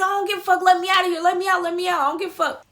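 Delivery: high, insistent retold outburst; defiant demand. Passenger overriding the driver's objection, defiantly. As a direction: talk over him, pitch held high.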